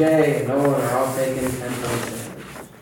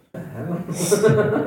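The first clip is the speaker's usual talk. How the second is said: confused goblin; 6 semitones higher, much slower than usual. Acted. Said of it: "he just kinda backs up slightly and is like".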